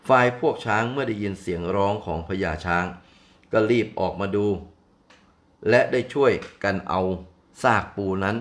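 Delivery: ordinary delivery, nothing marked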